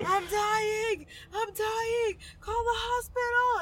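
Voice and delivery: high panicked yell, close to crying; mom yelling she's dying. Retold or imitated. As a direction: mimic a frantic mother screaming for help. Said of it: "my mom is yelling".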